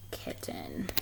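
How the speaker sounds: secret whisper of the name. A narrator's reading.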